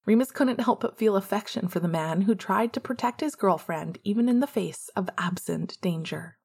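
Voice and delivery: ordinary delivery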